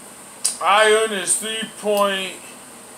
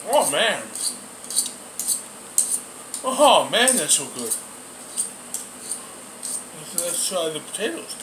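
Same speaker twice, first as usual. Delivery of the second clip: talking with food in mouth; chewing while talking; 7 dB quieter than usual. Real, not acted. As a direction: mouth partly full. Seen 2: Bites from fork at -1.0, mouth working while speaking; meat unclear.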